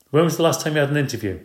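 wife challenging her husband; sharp retort. Acted. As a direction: fire the question back.

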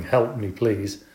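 ordinary delivery, nothing marked

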